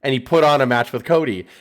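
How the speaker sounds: normal talking voice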